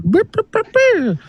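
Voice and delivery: high beeping sounds like a censor; mock censor beeps; imitated bleep. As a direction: beep out the swear words.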